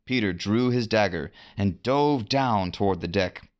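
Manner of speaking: usual speaking voice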